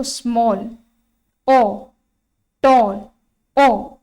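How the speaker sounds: "स्मॉल" and "टॉल" each followed by a loud, isolated "ओ"; vowel sound demonstration. Shown as a demonstration. Teacher showing students one vowel sound, "ओ" said alone and loud. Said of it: "स्मॉल की अगर मैं चर्चा करूं".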